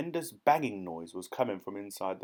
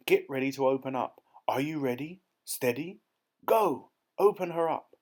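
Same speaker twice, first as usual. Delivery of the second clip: building command; 4 semitones higher, 5 dB louder than usual. A narrator's reading. Duke counting down the Pelican.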